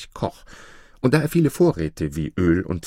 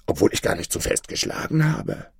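hushed, stricken protest; shaken; 8 semitones higher than usual. A narrator's reading. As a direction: protest in shocked disbelief, voice thinning. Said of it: "rief er erschüttert".

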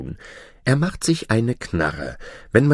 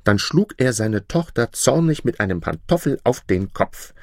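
plain reading voice

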